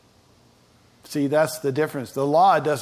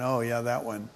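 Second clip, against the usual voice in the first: softer, as a guilty private thought; audience's inner thought; 4 semitones lower, 7 dB quieter than usual. Pretend. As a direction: mutter it like a caught listener admitting it. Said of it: "most of you right now are thinking".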